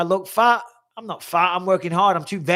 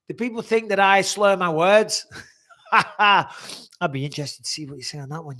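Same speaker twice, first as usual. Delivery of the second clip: laughing; 4 semitones higher, 4 dB louder than usual. Real, not acted. Laughing at own slur poll.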